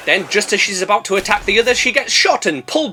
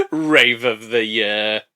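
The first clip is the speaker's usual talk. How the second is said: lower, slow mocking repeat; sarcastic echo; 8 semitones lower, much slower than usual. Narrator sarcastically echoing Chebs, slow mock. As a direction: sneer the phrase slowly. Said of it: "mocking repeat".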